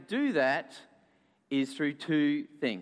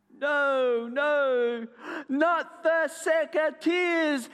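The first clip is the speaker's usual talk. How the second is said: high, drawn-out wail of protest; dramatic cry; 9 semitones higher, much slower than usual. A narrator's reading. Everybody pleads with the gardener, crying out. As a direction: lead the crowd in a hammed-up cry. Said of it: "everybody cried".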